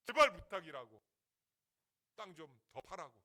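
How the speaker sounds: loud, urgent mock begging; pleading. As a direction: beg the workers, hitting the first word hard. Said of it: "록펠러가 사정사정을 해가지고".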